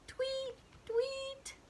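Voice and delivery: very high, soft chirping; bird tweet. Acted. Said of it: "The bird says".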